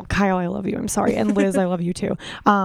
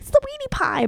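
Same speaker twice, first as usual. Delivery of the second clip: imitating mom; slower than usual. Voicing her mom.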